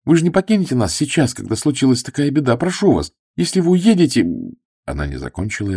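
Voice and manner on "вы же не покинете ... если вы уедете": put-on higher, pleading young woman; girl imploring him to stay. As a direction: beg him not to leave. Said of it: "взмолилась Флора".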